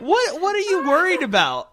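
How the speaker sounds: high squealing laugh through words. Real, not acted. Teasing grandma, laughing.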